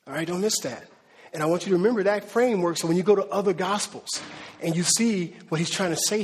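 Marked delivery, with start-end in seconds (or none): none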